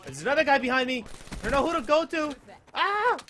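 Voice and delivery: high, loud alarm rising to a scream; panicked. Real, not acted. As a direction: panic building to a yelled "no". Cornered by two enemies.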